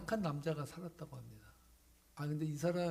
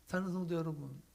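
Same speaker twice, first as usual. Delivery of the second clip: ordinary delivery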